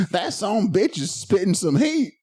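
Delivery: slow, laughing Southern drawl; Southern accent. Pretend. Imagining a Southern fan praising him, slow laughing Southern drawl. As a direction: drawl it out like an impressed country boy. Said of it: "I could bust out the Southern accent".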